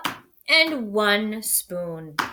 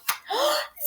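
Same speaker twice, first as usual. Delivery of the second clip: sharp high gasp; 5 dB quieter than usual. A narrator's reading. Reveal in storybook.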